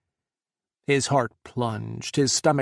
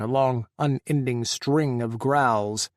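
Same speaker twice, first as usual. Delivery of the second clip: ordinary delivery, nothing marked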